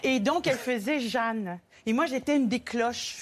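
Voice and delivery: ordinary talk, nothing marked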